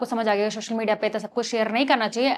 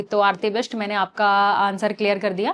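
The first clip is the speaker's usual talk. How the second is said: ordinary talk, nothing marked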